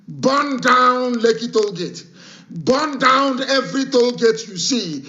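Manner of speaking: slow rallying shout; slow loud call. Real, not acted. Radio broadcaster urging his listeners, slow loud shout.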